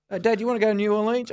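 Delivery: high, quick retold question; retold breezy question. Son asking Dad along to New Orleans, high and quick. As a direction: pitch it up and rattle it off.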